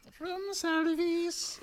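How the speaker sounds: very high, slow, drawn-out voice; motel lady impression. Mimicking the woman who came with him, high put-on voice. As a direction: put on an eerie little high-pitched lady. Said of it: "Like, one of those ladies".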